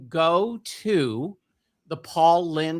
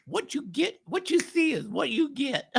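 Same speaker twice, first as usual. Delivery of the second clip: high, softer sassy Geraldine; Geraldine impression; 9 semitones higher, 4 dB quieter than usual. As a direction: purr the catchphrase as Geraldine. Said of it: "I do all those voices".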